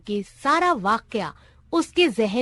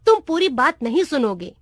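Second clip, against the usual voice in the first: plain reading voice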